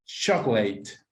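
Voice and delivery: "chocolate" said clearly for counting syllables; teacher's dictation of "chocolate"; slow model word. Shown as a demonstration. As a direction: model "chocolate" slowly as CHOC-o-late. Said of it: "How many syllables can you hear?".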